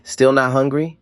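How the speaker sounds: light, high-pitched needling question; said lightly. A narrator's reading. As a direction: ask it airily, pitched well up. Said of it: "she said lightly".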